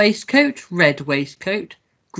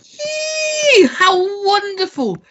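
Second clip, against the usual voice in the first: much higher, shouted "yee" of glee; delighted squeal; much slower than usual. Acted. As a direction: squeal like a child given a present. Master thrilled by ribbon gift.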